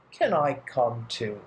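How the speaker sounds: ordinary delivery, nothing marked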